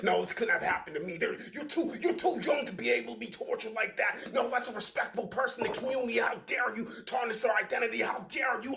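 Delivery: outraged accusers scolding the victim; indignant disbelief. Acted. Community shouting down the victim, indignant. Voicing people who disbelieve him.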